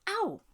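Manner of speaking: sudden high yelp of "Ow!"; sharp cry of pain. A narrator's reading. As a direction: yelp as if stung by a wasp. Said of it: "made a noise like".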